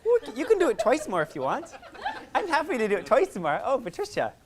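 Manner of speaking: high, loud laughing reply; teacher delighted by a keen student; laughing tease. Real, not acted. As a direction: chuckle as you encourage her. Teasing student warmly.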